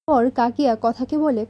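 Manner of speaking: ordinary delivery, nothing marked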